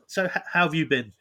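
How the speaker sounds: normal talking voice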